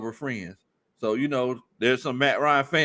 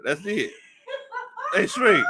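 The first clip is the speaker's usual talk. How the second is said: usual speaking voice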